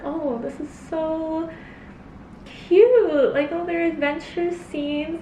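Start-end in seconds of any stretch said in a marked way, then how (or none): 0.0-3.3 gushing cute. Retold or imitated. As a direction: gush over how cute it is. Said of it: "I was like".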